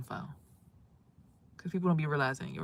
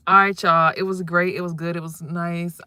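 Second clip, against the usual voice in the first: hasty sign-off; 11 dB louder than usual. Real, not acted. Wrapping up the live.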